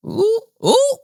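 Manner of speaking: high, squealed ooh; delighted high-pitched ooh; gleeful ooh. Real, not acted. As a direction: squeal it like juicy gossip. Gossip reaction to a match.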